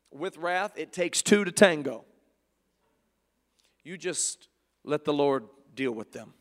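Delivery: ordinary talk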